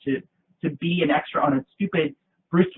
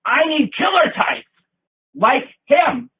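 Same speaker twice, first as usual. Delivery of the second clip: louder, higher mock bark; angry director; 10 semitones higher, 6 dB louder, much slower than usual. Super mad director picking her out of the crowd, higher and louder. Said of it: "And he said".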